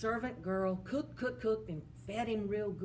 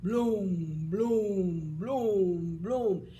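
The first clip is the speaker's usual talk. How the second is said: giant steps; 5 dB louder, much slower than usual. Acted. Giant climbing down.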